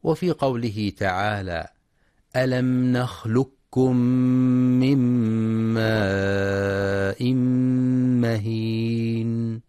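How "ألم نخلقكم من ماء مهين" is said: very slow sung chant, the last words stretched long; Quran recitation. Shown as a demonstration. Teacher chanting the verse for tajweed students, very slow. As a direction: sing the verse, holding every long vowel. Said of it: "في كلمة نخلقكم".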